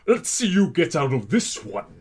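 put-on character voice, badly done; awful Roger. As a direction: do a bad impression in an altered voice. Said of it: "that was an awful Roger".

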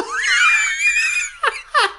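shrieking, very high laugh; high squealing laugh. Real, not acted. Speaker cracks up at own slip, squealing laugh.

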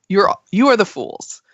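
usual speaking voice

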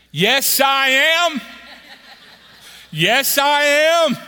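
loud, high, slow, drawn-out yes; stretched joyful cry; eager shout. Retold or imitated. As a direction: shout it out gleefully at the counter. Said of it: "My wife was saying".

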